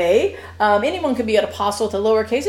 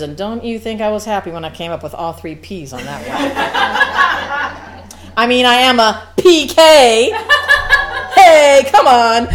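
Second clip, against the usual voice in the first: laughing, then a loud cheer; pleased with the pun, loud; laughing proudly; 4 semitones higher, 7 dB louder than usual. Real, not acted.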